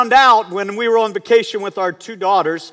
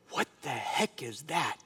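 slow, low and much softer; hushed alarm; 5 semitones lower, 15 dB quieter, slower than usual. Retold or imitated. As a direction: imitate a doctor's hushed, drawn-out reaction. Doctor's alarmed reaction, retold.

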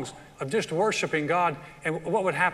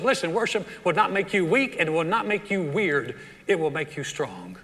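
usual speaking voice

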